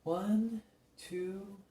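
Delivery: soft, absorbed counting to himself; counting under breath. Acted. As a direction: count quietly, lost in concentration.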